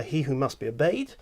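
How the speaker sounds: normal talking voice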